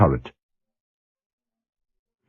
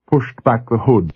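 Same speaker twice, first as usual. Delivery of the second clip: ordinary delivery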